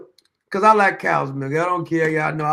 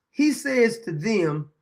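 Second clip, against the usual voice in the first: normal talking voice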